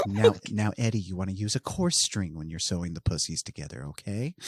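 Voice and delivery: mock mentor talking Eddie through sewing. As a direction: coach Eddie gently like a crafty grandma. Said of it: "Let me show you".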